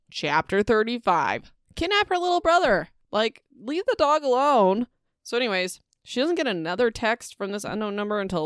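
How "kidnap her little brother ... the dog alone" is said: much higher, exasperated plea; sarcastic reader outrage; mock outrage. Real, not acted. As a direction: bargain with the villain in disbelief. Outraged reader bargaining with villain.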